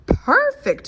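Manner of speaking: high, loud hoot of a word; hooting owl. A narrator's reading. Owl admiring the finished rocket, high pleased hoot. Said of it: "hooted Owl".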